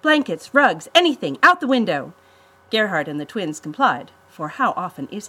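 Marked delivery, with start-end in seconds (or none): 0.0-2.1 high, loud rapid-fire orders; urgent commands. A narrator's reading. Claire orders siblings to throw supplies overboard, urgent. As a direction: fire off each item as an order. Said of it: "firing commands at her siblings".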